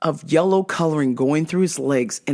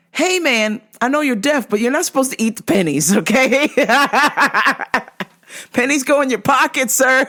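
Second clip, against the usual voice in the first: mock scolding; 7 semitones higher than usual.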